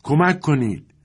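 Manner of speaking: high-pitched narrated call for help; cry for help. A narrator's reading. Voice in the yard at night, calling for help. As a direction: call out for help, pitch well up, moderately loud. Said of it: "صدایی در حیاط پیچید".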